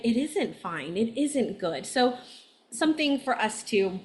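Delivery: ordinary delivery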